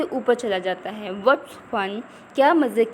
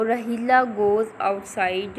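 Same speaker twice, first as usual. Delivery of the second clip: slow reading aloud; much slower than usual. Shown as a demonstration. Teacher reads text slowly.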